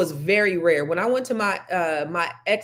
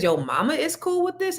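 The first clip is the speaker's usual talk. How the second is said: retold challenge, much higher pitch; mock-incredulous, pitch swooping up on the question; incredulous echo; 10 semitones higher than usual. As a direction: throw it back as a disbelieving question. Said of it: "And I said".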